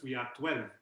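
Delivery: ordinary talk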